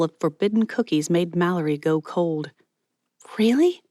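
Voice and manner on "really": high, louder startled gasp of a word. Acted. Mallory hearing Miss Patty has come, startled. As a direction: jolt at the news.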